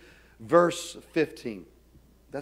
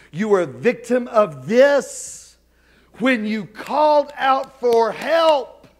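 slow, loud, higher preaching peak; preaching at full force; 9 semitones higher, 9 dB louder, slower than usual. Acted. Pastor driving the point home to the teenagers, preaching hard. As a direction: hammer it home slowly.

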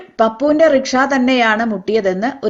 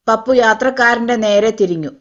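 plain reading voice

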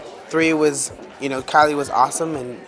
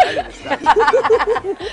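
laughing; 6 dB louder, much slower than usual. Real, not acted. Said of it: "that's what my metaphors sound like".

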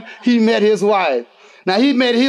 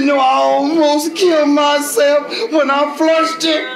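put-on upset drunk friend; 6 semitones higher than usual. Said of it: "He said".

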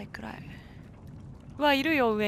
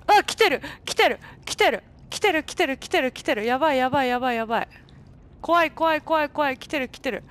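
being chased; 9 dB louder, much faster than usual. Real, not acted. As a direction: yell while fleeing in terror. Monster coming, she flees.

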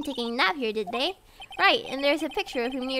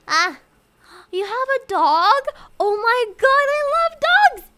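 high, loud, drawn-out gush of delight; excited squeal; 9 semitones higher, 8 dB louder than usual. Real, not acted. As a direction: squeal over the dog. Gushing over a dog.

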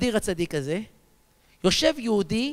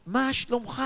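usual speaking voice

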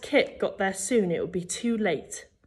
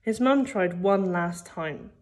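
plain reading voice

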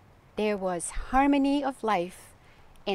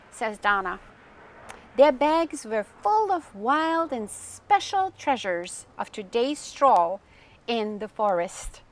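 ordinary delivery, nothing marked

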